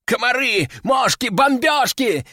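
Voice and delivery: high-pitched panicked cries; frantic shouting. A narrator's reading. Crazed man fleeing the capsule, frantic high cries. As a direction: cry out each word high, frantic. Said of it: "кричал бедолага".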